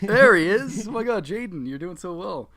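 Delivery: loud high praise; excited cheering. Real, not acted. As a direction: cheer them on loudly. Cheering friend's impression.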